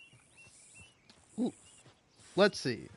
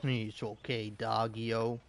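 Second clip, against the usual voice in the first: soft, much quieter pet talk; soothing the dog; 3 semitones lower, 9 dB quieter than usual. Real, not acted. Calming his barking dog Doggio, soft and tender. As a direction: hush him gently, like calming a pup.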